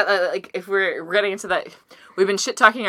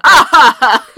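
laughing; 7 semitones higher, 12 dB louder than usual. Real, not acted. Cracking up at own bit.

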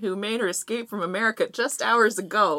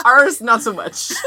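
laughing; 4 semitones higher, 11 dB louder, slower than usual. Real, not acted. Self-mocking with laughter.

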